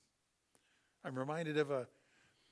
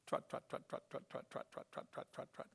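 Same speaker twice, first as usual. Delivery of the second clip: soft clip-clop of hooves, fading away; trotting noise; 2 semitones lower, 5 dB quieter, faster than usual. Retold or imitated. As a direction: mimic a donkey trotting, whispered. Imitating donkey trotting sound.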